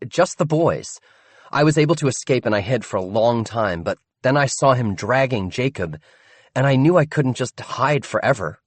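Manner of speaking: a calm, capable rescuer explaining; brisk Dot. Acted. Dot explaining to the boys how she rescued them. Dot's voice.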